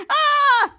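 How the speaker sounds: high, loud mock scream; acted scream. Ray zapped by electricity, mock screaming. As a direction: scream like a cartoon shock victim. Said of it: "And he's screaming".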